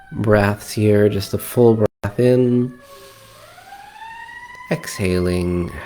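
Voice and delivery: calm meditation guide with long pauses; guided meditation. Acted. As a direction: ease them into relaxed breathing, leaving space between phrases. Leads a breathing meditation.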